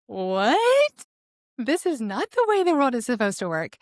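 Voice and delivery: high-pitched incredulous outburst; retold disbelief. Woman retells being dumped out of nowhere, incredulous. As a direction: replay the shock with a soaring "what".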